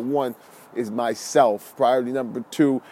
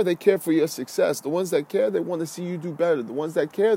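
usual speaking voice

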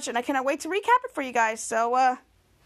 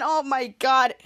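ordinary delivery

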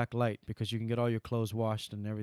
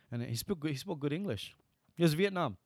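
usual speaking voice

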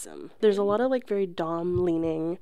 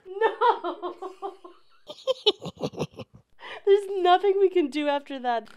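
high, loud shriek of laughter into the mic; real laughing scream at the sound; loud laughing outburst; 11 semitones higher, 6 dB louder than usual. Real, not acted. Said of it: "You're being so loud".

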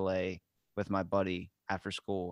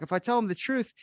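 much higher, panicky whining; imitating his own frantic fear, pitched way up; panicked; 9 semitones higher than usual. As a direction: squeak it out in mock panic. I'm like.

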